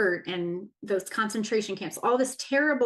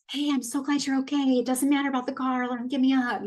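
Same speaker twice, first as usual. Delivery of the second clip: put-on higher, fast hopeful child voice; little girl voice; 4 semitones higher, much faster than usual. Speaker voicing her teenage self wishing mom said this, higher and fast. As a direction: voice the younger self pleading for a hug. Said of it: "this little girl that wanted to be like".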